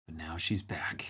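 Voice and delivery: hushed, slow whisper, like sharing a secret; dramatic whisper. Acted. Streamer whispers to chat that Old Bun is back, hushed. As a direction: breathe it out as a mock-ominous whisper. Dramatic reveal of returning friend.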